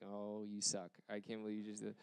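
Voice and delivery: very soft, teasing grumble under the breath; soft mock grumble at a friend giving a hint; muttered mock complaint. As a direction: playfully scold him almost to yourself.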